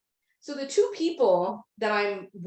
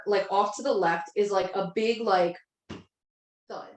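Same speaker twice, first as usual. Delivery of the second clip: ordinary talk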